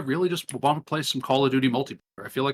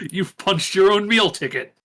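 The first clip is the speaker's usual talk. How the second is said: louder gloating villain taunt; 5 semitones higher, 4 dB louder than usual. Acted. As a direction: lord it over him, hammed-up villain.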